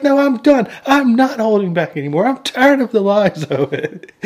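high, fed-up outburst, half laughing; mock yelling. Wife refuses to be hushed, high mock-angry yell. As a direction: imitate an aunt who has had enough. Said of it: "Aunt Beru's just yelling".

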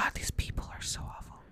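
unvoiced whisper to himself; whispered aside. Caller on hold whispering complaints about the dispatchers. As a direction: grumble it under your breath.

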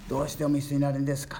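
quick, pleading cry from the cross; a hint of a forsaken son; 見捨てられた子の訴え; Jesus's plea on the cross. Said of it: "って言った時に".

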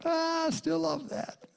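high, soft and slow affection; the Lord charmed by imperfect prayers; tender, loving God. Retold or imitated. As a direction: sigh it out with fond delight. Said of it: "the Lord's like".

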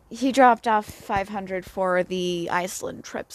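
ordinary delivery

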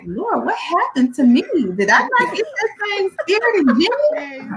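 high, laughing mock lament to the Lord; a hammed-up cry of dismay. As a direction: wail it to the heavens, half laughing. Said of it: "You're like".

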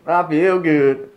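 slow, high mocking repeat; mocking echo. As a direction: parrot it back, drawn out.

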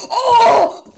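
sudden high, loud "Oh!" with a laugh; surprised yelp. Real, not acted. Friend reacting to a painful gif with a laughing yelp. Gif of door clamping a head.

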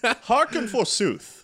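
put-on slow, high, grand old-English declaiming; hammy stage actor. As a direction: proclaim like a Shakespeare actor.

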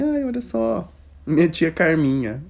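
high sweet mock voice; mock sweet boyfriend. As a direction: point out the aunt brightly and high. Boyfriend voiced, casual greeting.

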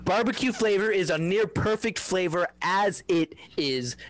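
heated. Real, not acted. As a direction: argue it like it matters. Heated chip argument.

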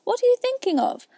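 very high, scolding mouse; severe. A narrator's reading. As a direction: scold sharply in a high-pitched voice. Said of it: "said the mouse to Alice severely".

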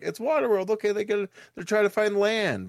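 eager, high voice; high hopeful. Retold or imitated. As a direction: sound naively hopeful. Imitated hopeful viewer thought.

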